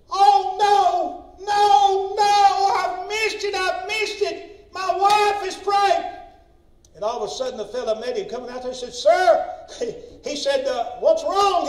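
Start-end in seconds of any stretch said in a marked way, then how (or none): 0.1-6.2 high, loud, drawn-out cry of acted alarm; panicked wail. Retold husband panics at missing Rapture. 8.9-9.5 loud, high call as the stranger. Retold or imitated. Retold stranger calls out to husband. 11.0-11.6 loud, high acted question; alarmed question. Retold stranger questions panicking husband.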